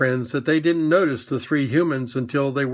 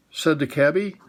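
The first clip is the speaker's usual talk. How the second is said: ordinary delivery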